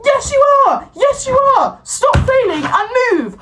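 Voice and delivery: high, snapping retort; fed up, hammering the point home; high sharp retort; exasperated retort. Acted. Snapping at imagined stranger.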